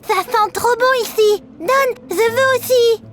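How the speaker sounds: put-on high, slow little-kid voice; squeaky drawn-out kid begging; little sister's voice. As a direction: demand a treat like an eager small child. Little sister demands cake.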